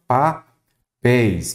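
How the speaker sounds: "papéis" split slowly as pa... péis; syllable by syllable. Shown as a demonstration. Teacher asking to separate syllables, "papéis" slowly split in two. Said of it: "separem em sílaba".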